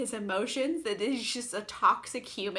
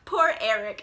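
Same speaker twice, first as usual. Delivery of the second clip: hammed-up sigh, higher and louder; mock lament; 6 semitones higher, 6 dB louder than usual. Christine alone in her dressing room, mock lamenting. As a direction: mourn it like a melodrama heroine. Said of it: "he hears her going".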